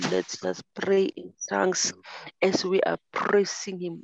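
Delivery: praying in tongues with rising fervor; fervent prayer. Real, not acted. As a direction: pour it out as a heartfelt prayer.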